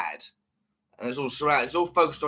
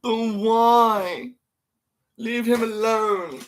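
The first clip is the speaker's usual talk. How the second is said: whiny mock voice; 8 semitones higher, much slower than usual. High slow mock voice.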